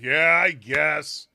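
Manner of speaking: drawn-out, reluctant concession; grudging approval. Acted. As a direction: concede it slowly, with great reluctance. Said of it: "a properly hesitant clap".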